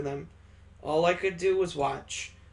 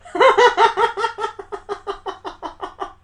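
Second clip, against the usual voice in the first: high, loud burst of rapid laughter; loud staccato giggling fit; long cackling laugh; 9 dB louder than usual. Acted. Laughing at the scene.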